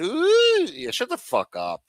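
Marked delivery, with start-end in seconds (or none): 0.0-0.6 mock ooh. Mocking viewers' reaction.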